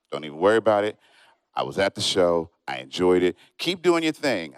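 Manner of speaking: a hint of a laid-back stranger; lightly voiced calm guy.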